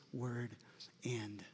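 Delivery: dropping to a whisper. Real, not acted. Preacher to congregation, hushed whisper ending. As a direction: let live fade to a whisper.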